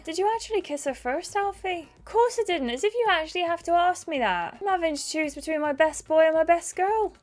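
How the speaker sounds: high-pitched, mildly indignant argument; indignant quarrelling characters. Acted. Acts out the game's quarrel.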